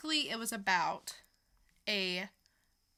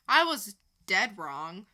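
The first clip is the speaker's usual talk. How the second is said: ordinary talk, nothing marked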